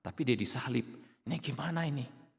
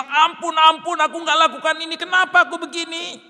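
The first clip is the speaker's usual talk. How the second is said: high, loud, desperate pleading; begging for mercy; 16 dB louder than usual. Pretend. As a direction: beg frantically for mercy. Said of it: "Apakah Yesus bilang".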